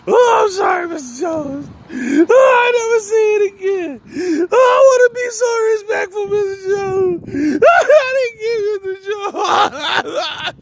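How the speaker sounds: over-the-top mock sobbing apology; hammed-up crying. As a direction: wail like a kid getting a whipping, begging. Kid beaten by the principal.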